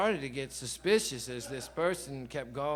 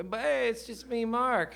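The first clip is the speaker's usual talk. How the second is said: higher, bright gotcha reveal of the prank; playful friend reveal; 8 semitones higher, 4 dB louder than usual. Retold or imitated. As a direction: drop the act and let him in on the joke. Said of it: "he'd always go".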